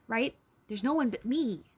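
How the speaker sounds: ordinary delivery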